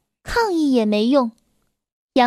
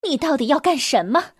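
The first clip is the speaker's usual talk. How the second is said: usual speaking voice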